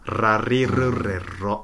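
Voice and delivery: ラリルレロ with every r rolled, slow. Shown as a demonstration. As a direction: trill each r in ラリルレロ, drawn out. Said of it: "どんな風にやんの？".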